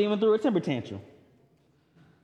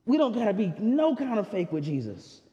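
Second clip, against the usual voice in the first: ordinary talk, nothing marked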